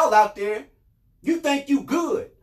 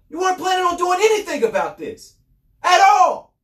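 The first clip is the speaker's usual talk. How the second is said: high, raised, furious voice; angry rebuttal; 7 semitones higher, 3 dB louder than usual. Real, not acted. Host calling out officials who stalled six months, angry. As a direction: build to an outraged finish on "at all".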